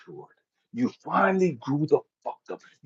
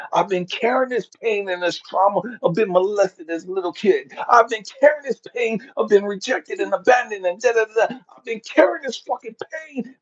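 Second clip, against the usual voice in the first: building rant; 6 semitones higher, 4 dB louder than usual. Pretend. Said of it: "sit there and say".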